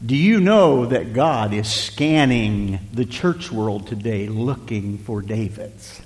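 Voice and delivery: soft preaching. Acted. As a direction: lean in and slow right down. Drops to soft slow preaching.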